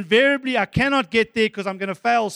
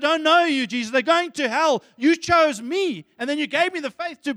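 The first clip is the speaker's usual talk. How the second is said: raised and slowed, each word held; 6 semitones higher, 4 dB louder than usual. Acted. Grateful prayer voiced aloud.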